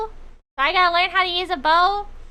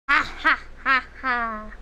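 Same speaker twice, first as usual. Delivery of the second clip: slow theatrical villain laugh; evil laugh; 5 semitones lower, slower than usual. Pretend. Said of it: "I was looking for my evil face".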